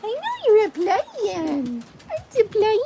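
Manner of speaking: sing-song high pet voice; high pet talk. Real, not acted. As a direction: coo at the playful dog. Cooing at playing dog.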